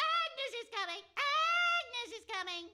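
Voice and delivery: very high panicked fairy shrieks; screaming fairies. Retold or imitated. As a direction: shriek like a tiny terrified fairy. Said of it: "The fairies were screaming".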